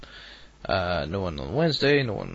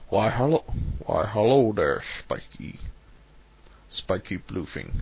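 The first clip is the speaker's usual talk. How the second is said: fake Sean Connery. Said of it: "I sounded like the fake Sean Connery".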